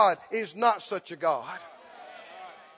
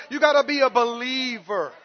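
ordinary talk, nothing marked

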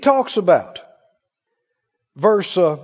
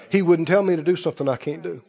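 ordinary delivery

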